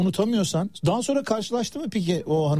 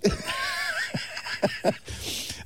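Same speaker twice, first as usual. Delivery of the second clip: big laugh; 7 dB quieter than usual. Real, not acted. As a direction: let out a hearty cackle. Laughing at chocolate gift.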